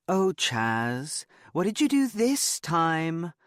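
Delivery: very high, gushing cheerleader squeal of delight; high-pitched pom squad impression, drawn out. A narrator's reading. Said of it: "her highest-pitched Pom Squad impression".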